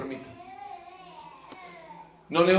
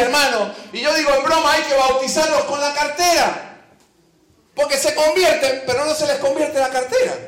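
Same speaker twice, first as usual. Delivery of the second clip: ordinary delivery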